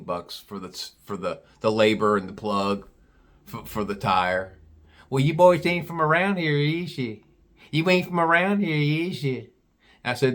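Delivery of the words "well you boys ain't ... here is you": imitated Southern gal, arched tune; deep Southern accent. Gal questions out-of-town boys, mock Southern accent. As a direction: quiz them in a thick Southern accent. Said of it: "She had a real deep Southern accent".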